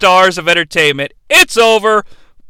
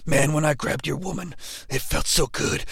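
whispered, much softer villain promo; Jake creepy whisper; 5 semitones lower, 12 dB quieter than usual. Retold or imitated.

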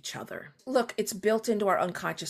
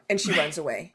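usual speaking voice

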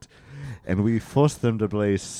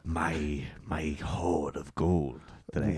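gloating miser; 7 semitones lower, 11 dB quieter than usual. Acted. Greedy rich man gloating.

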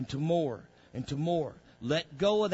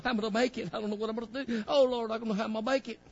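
higher, pleading voice of a struggling believer; mock lament; 8 semitones higher, much faster than usual. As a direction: act out a worried believer's cry. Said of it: "acted lament of a struggling believer".

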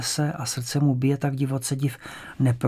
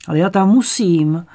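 pleading; 5 semitones higher, 7 dB louder than usual. A narrator's reading. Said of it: "Skoro prosí".

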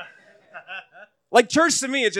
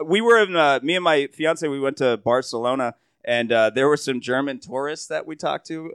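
ordinary talk, nothing marked

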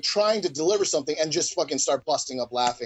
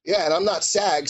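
normal talking voice

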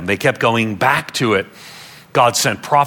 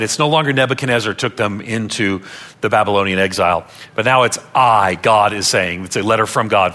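usual speaking voice

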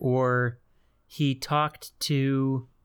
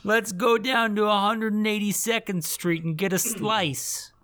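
put-on New York accent; 7 semitones higher than usual. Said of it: "the Bronx Bombers".

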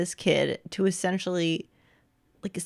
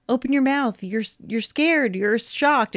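raised-pitch mock director's instructions; a hint of a director coaching from off camera; lightly voiced director; 6 semitones higher than usual. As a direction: imitate a director prompting an actor. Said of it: "because the director was like".

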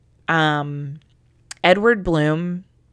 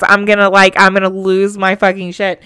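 overwhelmed outburst; 3 semitones higher, 11 dB louder than usual. Retold or imitated. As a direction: retell the moment you break down, loud. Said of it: "and I was like".

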